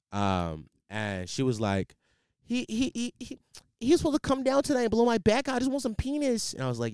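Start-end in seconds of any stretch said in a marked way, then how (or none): 2.4-6.5 imitating a friend, much higher, stammering; hammed-up high complaining friend; high mock friend voice. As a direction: squeak it out in a put-on high voice.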